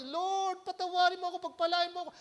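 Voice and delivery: mimicking a weeping sinner begging forgiveness; mock tearful prayer. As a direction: plead with the Lord in mock tears. Umiiyak sa harapan.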